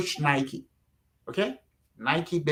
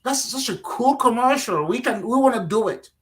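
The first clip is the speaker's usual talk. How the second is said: higher-pitched imitated enthusiasm; excited, delighted; 6 semitones higher than usual. Company reacting to his shoe pitch, imitated excitement. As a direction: act out a client thrilled by the pitch.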